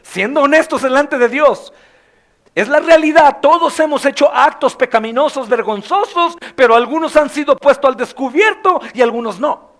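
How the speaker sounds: loud fervent preacher's appeal; preaching at full force. Acted. Preacher urging the brothers to honesty, loud and fervent.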